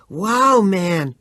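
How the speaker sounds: high, loud and panting. Acted. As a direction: gasp it out after running. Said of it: "he panted".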